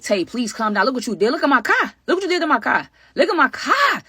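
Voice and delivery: high, loud panicked pleading about the car; frantic pleading. Retold or imitated. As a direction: beg her to stop, rising in panic. Frantic pleading over smashed car.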